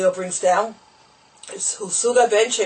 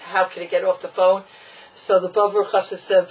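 normal talking voice

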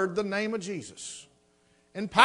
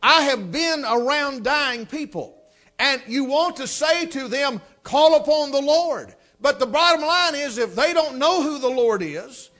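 louder, higher, driving preacher's voice; full-force preaching; 5 semitones higher, 5 dB louder than usual. Acted. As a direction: preach it with fire.

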